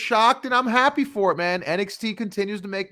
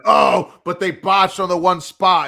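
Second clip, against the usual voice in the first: loud mock-outraged complaint; hammed-up nitpicking fan; outraged objector; 2 semitones higher, 8 dB louder, slower than usual. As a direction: burst in with a loud scoffing "oh". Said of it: "I'm sure someone heard us say".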